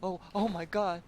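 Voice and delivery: ordinary talk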